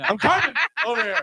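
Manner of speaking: high, laughing announcement. Real, not acted. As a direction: laugh through the words. Laughing while announcing Carmen.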